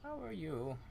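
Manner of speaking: put-on old woman voice.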